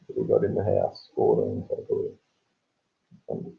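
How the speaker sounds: unvoiced, muttered to himself; whispered aside. Real, not acted. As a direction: whisper it under your breath as an aside. Muttering to himself while checking.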